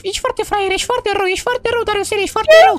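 put-on high falsetto girl voice, teasing; mock the boy in a high girly voice; high put-on girl voice teasing about his muscles. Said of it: "trebuie să fac vocea".

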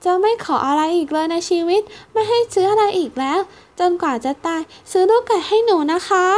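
high child voice, lightly acted sobbing; understated tearful child promise; sobbing plea. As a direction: beg through mild tears like a small girl. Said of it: "พูดพลางสะอื้นว่า".

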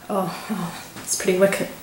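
ordinary talk, nothing marked

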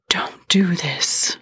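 slow, breathy whispered protest; whispered plea. Acted. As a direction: whisper it, slowly begging. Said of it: "the mermaid protested".

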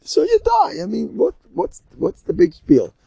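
exasperated retort in a storyteller's high voice; imitated yell back. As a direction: yell back as if death were no big deal.

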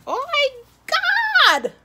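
very high, loud, drawn-out Oh my God; shocked shriek. Real, not acted. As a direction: shriek it out in real disbelief. Shock at the count.

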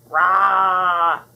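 loud, sustained "rah" roar; monster roar. A narrator's reading. Unseen monster frightening the girls, loud roar. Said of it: "heard a frightening sound".

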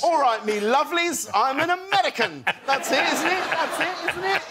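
high-pitched, hammed-up British take on American; deliberately bad American accent. Actor jokingly previews his role to the host, hopeless American accent. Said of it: "a preview of your American accent".

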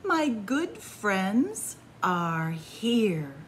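gentle, lively bedtime storytelling; cozy storybook narration; reading to children. A narrator's reading. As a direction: read it like a picture book to little ones. Reads a picture book aloud.

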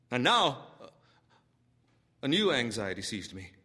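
plain reading voice

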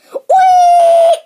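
high, loud squealing whee with laughter; gleeful squeal. Acted. Kid squealing with glee, high and loud. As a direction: squeal like you're on a slide.

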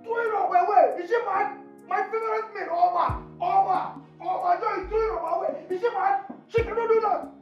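high-pitched, hammed-up horror; over-the-top wailing indignation; outraged disbelief. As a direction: cry out in scandalized disbelief. Horrified at Opa being thrown away.

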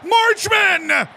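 high, loud yell of the player's name; cheering a goal; excited shout. Real, not acted. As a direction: call his name out in delight.

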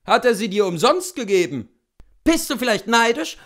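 loud, quick, high questions; teasing challenge. A narrator's reading. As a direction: fire the questions back briskly. Coachmen bantering about the boots.

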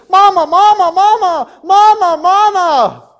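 high, loud calls from far off; yelling for mama. Retold or imitated. Miriam runs home calling her mother, high loud yells. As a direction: shout for mom across the yard. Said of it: "and she's yelling".

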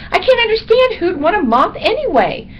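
high, loud incredulous bat; squeaky bat indignation; disgusted little bat. A narrator's reading. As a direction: squeal in disbelief.

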